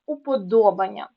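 упоДОбання, stressed on ДО; уподобання as an exception, stress on ДО; stress demo. Shown as a demonstration. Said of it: "тому що від слова подобатись".

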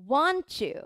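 "want you" run together as "wan-chu"; CH-blend. Shown as a demonstration. Teacher explaining the linking to learners, "want you" as wan-chu. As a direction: say "want you" with a Japanese chuu at the end. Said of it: "日本語のチューみたいに".

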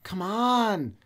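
high, drawn-out mock whine; stretched, wheedling whine; whining dog. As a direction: plead like a needy dog begging for attention. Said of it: "like, whine".

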